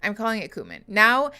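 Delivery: normal talking voice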